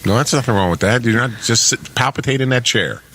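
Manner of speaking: ordinary talk, nothing marked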